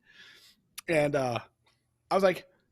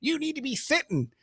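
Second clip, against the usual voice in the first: higher, snotty officer's order; smart-ass officer; 9 semitones higher than usual. Retold or imitated. Captain orders the giant gunner to sit, smart-ass. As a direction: talk down to him like a know-it-all captain. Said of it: "with all that fucking smart-ass attitude".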